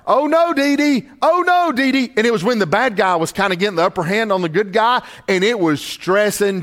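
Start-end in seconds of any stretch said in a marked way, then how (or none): 0.1-2.1 put-on high, panicked little boy's cry; panicked child. Grandfather voicing grandson scared by a movie, high panicked cry. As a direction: cry out like a scared three-year-old. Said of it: "he was saying this out loud".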